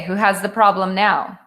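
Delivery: usual speaking voice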